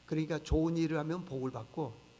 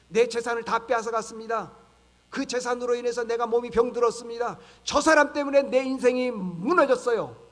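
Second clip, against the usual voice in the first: loud, raised-pitch victim's complaint; acted bitter grievance; 4 semitones higher, 8 dB louder than usual. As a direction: cry out as the wronged party, building louder. Voicing a wronged victim's grievance. (억울함을 크게 호소하는 연기)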